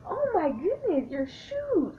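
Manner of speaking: high, slow, squealed admiration; gushing compliment. Retold or imitated. Overheard bathroom compliment to another girl, high squealing gush. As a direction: gush over a stranger's shoes. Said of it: "complimenting each other in the bathroom".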